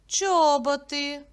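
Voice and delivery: usual speaking voice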